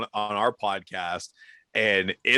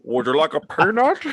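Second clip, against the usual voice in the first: higher, louder hammed-up giant; mock giant voice; 4 semitones higher, 5 dB louder than usual. Offering a peanut as the giant, laughing mock voice. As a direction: put on a big giant voice through laughter.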